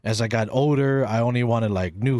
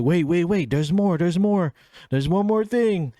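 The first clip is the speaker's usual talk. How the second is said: higher, building excitement; eager teasing; 7 semitones higher than usual. Retold or imitated. Teller stopping the family for one more surprise, high and eager. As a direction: hold them back, bursting to reveal.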